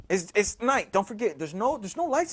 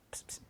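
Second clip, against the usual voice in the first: hushed, hissed psst; quiet psst to get attention; whispered psst; 26 dB quieter than usual. Retold or imitated. A voice calls him in the dark, whispered psst. Voice he hears while lying down.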